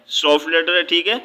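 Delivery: normal talking voice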